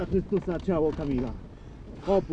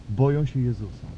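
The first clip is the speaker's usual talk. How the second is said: usual speaking voice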